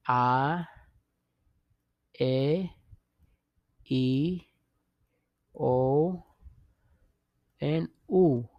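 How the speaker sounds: A, E, O, U as "ah, eh, oh, oo"; A, E, O, U one by one, each lifting, long pauses; Spanish vowel drill. Shown as a demonstration. Teacher leading learners to repeat, Spanish vowels spaced out. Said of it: "can you say it again?".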